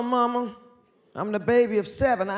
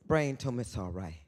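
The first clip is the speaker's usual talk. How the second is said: usual speaking voice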